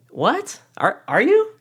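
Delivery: put-on stunned kids who can't believe it; incredulous classmates. Classmates staring at the boy who jumped up, squeaky incredulous.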